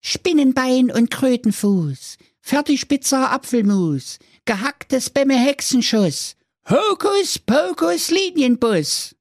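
very high chanting spell, slowing at the end; magic spell. Acted. Youngest son casting a spell, high and chanting. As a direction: cast the spell with flourish. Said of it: "sprach der Klaus drei ein Zaubersprüchlein".

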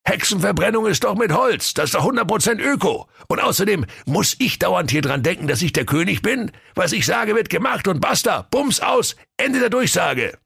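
a spoiled king moaning; bored king. Acted.

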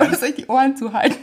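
giggling through words; fighting back laughter; laughing. Real, not acted.

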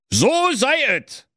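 high royal proclamation; booming warrior king. Acted. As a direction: call it out like a king sealing a deal. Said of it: "rief der König".

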